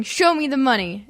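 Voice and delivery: normal talking voice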